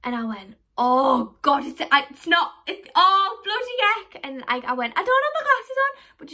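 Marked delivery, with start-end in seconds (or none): 0.8-4.2 loud, rising flustered stammer; flustered panic. Retold or imitated. Realising the cleaner isn't her teacher, loud flustered. 5.0-6.0 high, loud flustered excuse; high embarrassed excuse. Retold or imitated. Excusing herself to the cleaner, high flustered blurt.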